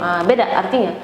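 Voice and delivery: ordinary talk, nothing marked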